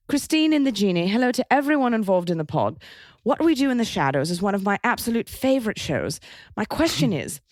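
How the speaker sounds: posh British letter-reading; British RP accent. Shown as a demonstration. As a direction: read out fan mail in careful put-on RP. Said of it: "in a R P".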